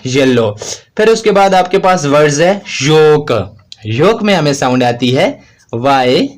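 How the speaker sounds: ordinary delivery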